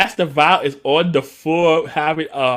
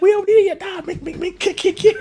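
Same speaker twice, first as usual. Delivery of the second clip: much higher, giddy show-off voice; high mock voice; 5 dB louder than usual. Ranger bragging about flips, high giddy voice. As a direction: squeak it out like a kid showing off.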